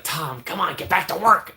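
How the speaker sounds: mock scolding. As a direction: shoo a friend back to work, jokingly. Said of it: "I'd be like".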